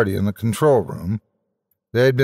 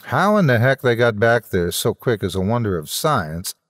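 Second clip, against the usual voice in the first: plain reading voice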